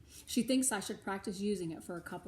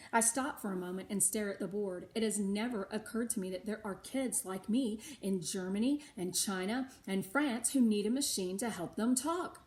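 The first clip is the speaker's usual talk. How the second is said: plain reading voice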